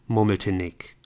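usual speaking voice

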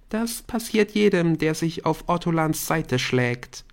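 put-on high, ominous teen girl; eerie storytelling girl; Bryn voice. A narrator's reading.

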